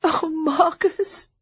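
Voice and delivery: high, slow sobbing cry of his name. Acted. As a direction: let the name break into a sob. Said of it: "she sobbed".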